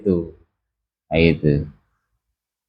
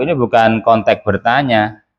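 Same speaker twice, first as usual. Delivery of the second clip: normal talking voice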